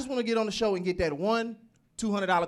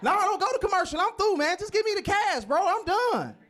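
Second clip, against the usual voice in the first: excited refusal; 14 semitones higher than usual. Retold or imitated. Contestant insists on quitting.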